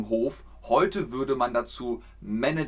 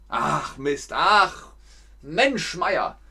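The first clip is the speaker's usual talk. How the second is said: playful frustration; 3 semitones higher, 3 dB louder, much slower than usual. Shown as a demonstration. Teacher closes the lesson with mock frustration. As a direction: ham up the frustration. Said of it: "wie ging der Satz jetzt nochmal".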